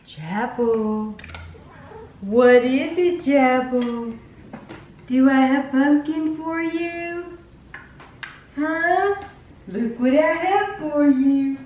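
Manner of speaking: talking to a pet. Real, not acted. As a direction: coax the dog sweetly. Talking to the dog.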